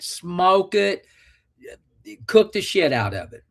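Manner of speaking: normal talking voice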